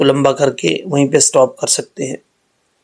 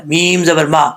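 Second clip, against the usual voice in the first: ordinary delivery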